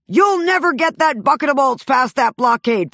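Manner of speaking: a lightly hammed space princess ordering the pilot; mock Princess Leia line. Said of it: "my performance as Princess Leia".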